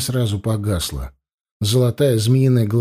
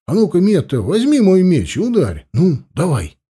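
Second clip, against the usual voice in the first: eager dare; 6 semitones higher than usual. A narrator's reading. Anil dares Metta to strike.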